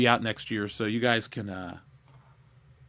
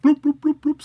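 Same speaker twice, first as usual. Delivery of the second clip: imitated Skype bloop; 13 semitones higher, 7 dB louder than usual. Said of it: "sound effect".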